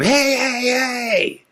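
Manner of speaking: high yelling hey; shrill high shout; screaming. Retold or imitated. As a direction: yell to stop the bus driver. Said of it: "I just scream".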